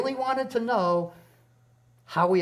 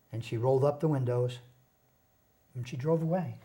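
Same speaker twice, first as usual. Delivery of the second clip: ordinary talk, nothing marked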